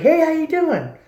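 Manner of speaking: high, bright-toned Southern hello; put-on Southern accent. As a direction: greet like a small-town Southerner, up high. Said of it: "I put an accent on for them".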